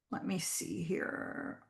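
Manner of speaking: slow whisper to herself; slow private whisper, checking her notes; whispered aside. Real, not acted. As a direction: breathe it while checking notes.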